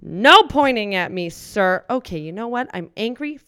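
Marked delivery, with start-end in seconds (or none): 0.0-1.8 loud sharp warning; stern scold. Acted. Theater worker scolds the pointing customer, loud and stern.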